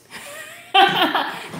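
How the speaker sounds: high-pitched burst of laughter; real high, tickled laugh; amused laugh. Real, not acted. Amused at her own example.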